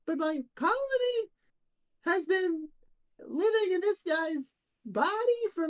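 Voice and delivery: very high, chatty ant; high-pitched little ant voice; tiniest little voice. Acted. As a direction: squeak it out as a tiny ant thinking aloud. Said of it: "the tiniest little voice go".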